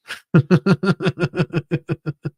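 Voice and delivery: a sudden loud laugh; real burst of laughter. Real, not acted. Streamer reacting to chat.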